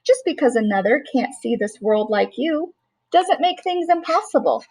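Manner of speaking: put-on measured read-aloud voice for a child; a parent reading a rhyming story aloud; storybook narration. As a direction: read it like a bedtime picture book. Mother reads picture book aloud.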